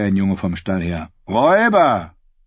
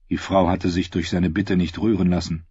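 usual speaking voice

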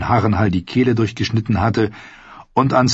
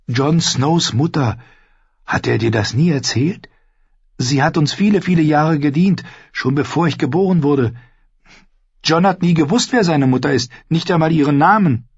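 higher, earnest storytelling voice; young squire Ned; 7 semitones higher than usual. Acted. Ned recounts a battle to Arya, earnest and higher. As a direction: voice a squire recounting his deed, pitched up.

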